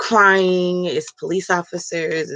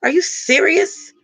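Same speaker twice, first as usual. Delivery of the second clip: incredulous; 8 semitones higher, slower than usual. Real, not acted. Outraged at calls to forgive.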